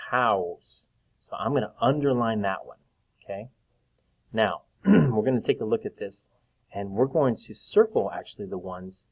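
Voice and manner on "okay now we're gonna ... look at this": ordinary talk, nothing marked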